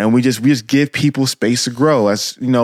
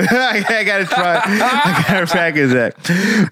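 laughing; 7 semitones higher, slower than usual. Real, not acted. Laughing at own failed accent attempt.

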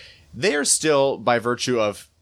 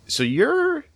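high mimicking friend's voice, a lot higher; quoting a friend; 3 dB louder than usual. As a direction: quote her in a much higher voice. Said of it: "she was like".

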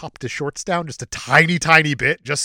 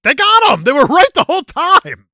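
mock cheer; 13 semitones higher, 11 dB louder than usual. Cheering at the rescue.

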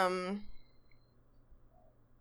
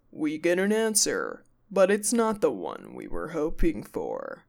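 lightly imitated TV narration, pitched down; lower, measured mock voice-over; a hint of show narrator; narrator impression; 6 semitones lower than usual. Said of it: "the narration of Zach goes".

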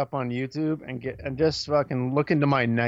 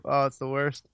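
usual speaking voice

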